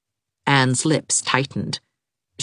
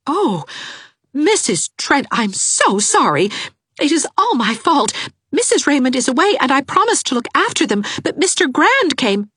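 much higher, quick and breathless sorry; apologetic young governess; 12 semitones higher than usual. A narrator's reading. Governess apologises to angry neighbour, high and hurried. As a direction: gush an apology, rushing through the excuse.

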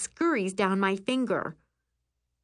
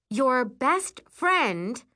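slow, high, rising disbelief; incredulous echo; 6 semitones higher, 3 dB louder, much slower than usual. A narrator's reading. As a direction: repeat it slowly, unable to believe it. Narrator echoes in disbelief.

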